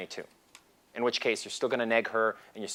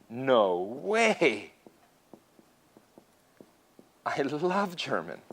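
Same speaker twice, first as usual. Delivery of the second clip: imitating an excited girl; much slower than usual. Voicing the girl's reaction.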